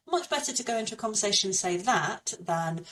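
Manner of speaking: ordinary talk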